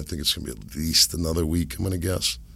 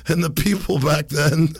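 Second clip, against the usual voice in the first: high, put-on know-it-all voice; ranting science zealot; 9 semitones higher, 4 dB louder than usual. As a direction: imitate a zealot unloading, pitched way up. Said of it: "This dude just unloads".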